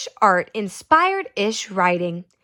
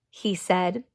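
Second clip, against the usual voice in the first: ordinary delivery, nothing marked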